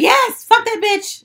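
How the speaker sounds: high, loud, slow mock cheer; hammed-up delighted yell; gleeful cheer. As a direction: cheer it like good riddance. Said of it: "Everybody was like".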